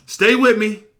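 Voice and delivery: high, loud call for attention. Real, not acted. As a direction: call the listeners back sharply. Calling the congregation back to attention.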